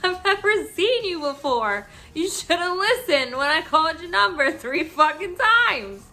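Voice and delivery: loud, high exasperated comeback; indignant retort. Retold or imitated. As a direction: re-enact losing patience with a customer. Acted quarrel with the rude customer.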